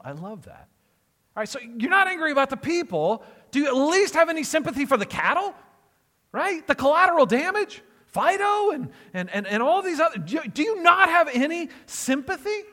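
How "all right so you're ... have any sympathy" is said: higher and louder, incredulous appeal; exasperated high pleading. Acted. God asks Jonah about the cattle, high exasperated pleading. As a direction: push the questions up, almost begging.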